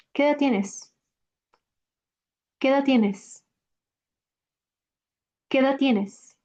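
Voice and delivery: quick "¿Qué edad tienes?" with "qué edad" fused; casual native pace; fluent question; natural speed. Shown as a demonstration. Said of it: "I'll say it at natural speed".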